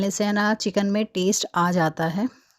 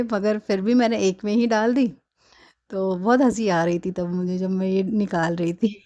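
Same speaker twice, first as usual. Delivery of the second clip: usual speaking voice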